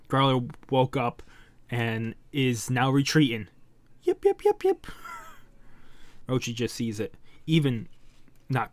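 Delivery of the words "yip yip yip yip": rapid, very high-pitched yelps; frightened dog yips. Acted. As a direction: yelp like a retreating pup. Retreating monster dog yelps.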